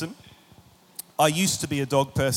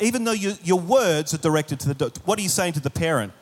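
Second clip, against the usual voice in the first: usual speaking voice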